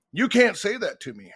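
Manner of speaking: mildly offended referee's objection; retold indignant referee. Referee skates over to coach who insulted him, mock indignant.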